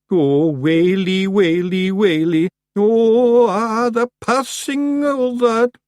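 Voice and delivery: high, slow, loud keening; slow lone wail. Acted. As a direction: moan it on your own, drawn out. One Feagle can't stop.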